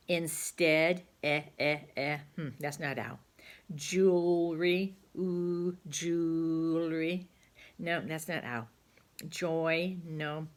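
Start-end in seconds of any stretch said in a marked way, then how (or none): 3.7-5.0 slow sounding out. Shown as a demonstration. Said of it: "slow sounding out". 5.2-7.3 slow sounding out. Shown as a demonstration. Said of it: "slow sounding out again".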